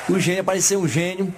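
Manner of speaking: ordinary delivery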